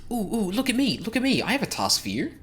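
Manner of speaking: high, eager pixie calling for attention. Acted. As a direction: chirp it up like an excited little pixie. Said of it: "I'm not doing that accent".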